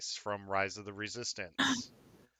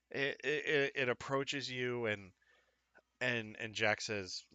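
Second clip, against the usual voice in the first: ordinary delivery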